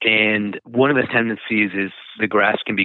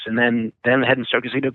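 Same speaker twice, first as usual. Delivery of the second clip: ordinary talk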